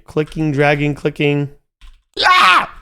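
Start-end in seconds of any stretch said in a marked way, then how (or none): 2.2-2.7 high, loud sudden "Ah"; startled cry. Real, not acted. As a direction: yelp at the sudden breakthrough. Game finally works after dragging.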